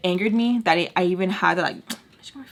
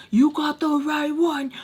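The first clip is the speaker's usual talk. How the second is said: higher-pitched mock threat; pissed; 7 semitones higher than usual. Tommy warning the girls, raised-pitch threat. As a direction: warn them off, still holding back. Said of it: "just pissed".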